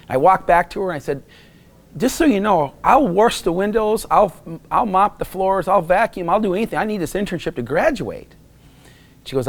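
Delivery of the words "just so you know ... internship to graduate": high, insistent bargaining, each offer stretched; retold pleading. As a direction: plead for a chance, pitch well up.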